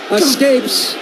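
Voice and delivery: ordinary talk, nothing marked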